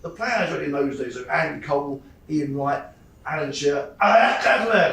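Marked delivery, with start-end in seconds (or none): 4.0-4.9 loud, hammed-up French pronunciation; overly French accent. Pub chat with lads about football, overblown French accent. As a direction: burst into a throaty mock-French accent. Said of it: "most overly French accent ever".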